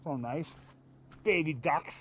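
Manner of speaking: toothbrush in mouth, mumbled; while brushing teeth. Real, not acted. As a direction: talk around a toothbrush. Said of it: "I'm brushing my teeth".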